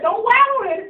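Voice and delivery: loud high cry of the advice; quoting dad with a loud, charged shout; emphatic shout. Retold or imitated. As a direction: shout it like a father's rule.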